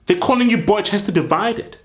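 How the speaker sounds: ordinary talk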